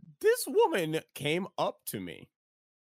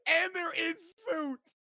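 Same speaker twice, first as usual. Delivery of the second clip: giggly; 13 semitones higher, much slower than usual. Real, not acted. Laughing at the photo.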